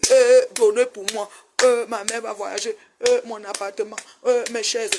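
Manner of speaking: lightly mocking plea, drawn-out at first; mimicked beggar. Vlogger mimics people asking her for things, mock pleading. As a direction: imitate someone pestering for handouts.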